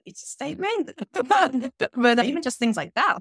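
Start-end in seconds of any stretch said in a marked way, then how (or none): 0.1-0.9 uptalk reply. Retold or imitated. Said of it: "And I'd be like". 1.0-1.9 laugh. Real, not acted. Laughs at own story.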